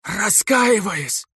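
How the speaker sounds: high, drawn-out anguished plea; desperate high wail. A narrator's reading. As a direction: cry it out in agony. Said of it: "с надрывом". (надрывная мольба)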